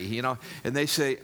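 normal talking voice

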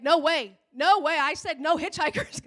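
loud, indignant mock refusal; mock protest. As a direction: argue back loudly, voice jumping up. Driver refuses God's request.

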